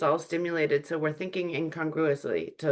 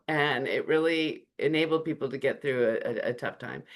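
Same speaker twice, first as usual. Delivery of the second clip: ordinary talk, nothing marked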